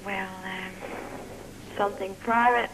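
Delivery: usual speaking voice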